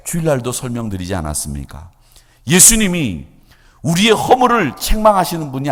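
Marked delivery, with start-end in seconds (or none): none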